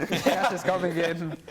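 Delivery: slow, laughing repeat; laughing through the words; laughing echo. Real, not acted. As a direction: echo the joke while laughing.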